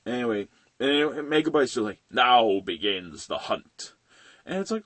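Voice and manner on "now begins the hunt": slow, low menacing villain line; mock villain line. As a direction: draw it out like a gloating villain. Voicing villain Megabyte.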